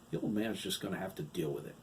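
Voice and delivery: ordinary delivery, nothing marked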